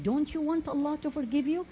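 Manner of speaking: ordinary talk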